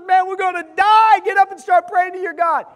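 loud, high panicked yell; panicked captain. Retold or imitated. Captain yells at sleeping Jonah in loud panic. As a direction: wake him in a terrified shout. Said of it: "the captain wakes him up and says".

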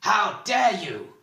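loud, high, drawn-out outrage; furious shout. A narrator's reading. Bald man turning on Oric, furiously shouting. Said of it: "he shouted".

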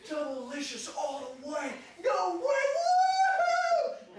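high, loud Doublelicious rising to a screaming woo; mock ecstatic scream. Parody driver at KFC, screaming in mock ecstasy. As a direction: gush over a fast-food sign. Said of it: "goes through the drive-thru like that".

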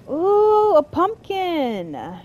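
high, loud, drawn-out delight; delighted surprise. Real, not acted. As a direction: coo loudly over an exciting find, stretching it out. Spots a pumpkin while exploring.

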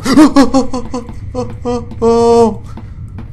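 loud high startled gasp; sharp scared gasp. Real, not acted. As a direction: gasp as something jumps out. Monster appears suddenly.

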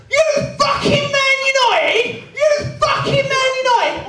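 high, loud menacing roar; thug's bellow. Retold or imitated. Huge fan looming over him, bellowing. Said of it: "looms over the front of me and goes".

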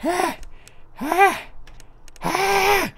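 short, very high frightened cries; high startled shrieks; panicked yelps. Real, not acted. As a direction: yelp in sudden terror. Cries of panic during attack.

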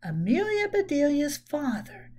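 ordinary delivery, nothing marked

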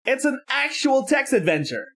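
high, bright exclamation of delight; delighted aside. Real, not acted. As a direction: marvel at the game.

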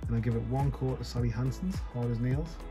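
normal talking voice

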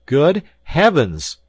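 stunned at the beast's size, crying out; astonished cry. A narrator's reading. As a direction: burst out in amazement. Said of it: "I cried".

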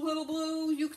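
normal talking voice

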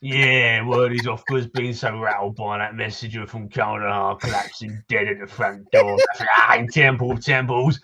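put-on townsman in a Cockney accent, gruff and chatty; grumbling Cockney local greeting a stranger; Cockney NPC. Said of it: "for the accent compliment".

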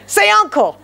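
high-pitched mock taunt; American wrestler's demand. Showing what Americans say when wrestling, high mock demand.

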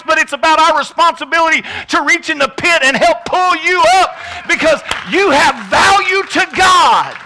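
loud, high preaching building to a shout; impassioned preaching. Real, not acted. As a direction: build relentlessly to a full-force pulpit climax.

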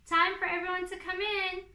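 loud, high call across the yard; a mother calling her child inside; mother calling kids in from the storm. A narrator's reading. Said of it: "called his mother".

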